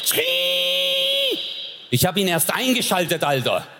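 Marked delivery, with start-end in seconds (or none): 0.0-1.4 high squeaky mechanical whine; mock machine squeal; VCR whirring noise. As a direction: imitate a noisy old video recorder. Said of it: "der so".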